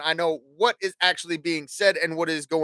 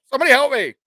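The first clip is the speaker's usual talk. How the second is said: mock cry for help; 6 semitones higher, 6 dB louder, much faster than usual. Mock plea, held hostage by kids.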